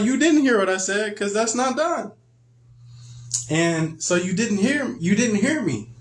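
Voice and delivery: high, insistent parent scolding. Retold or imitated. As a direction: hammer the child for not listening.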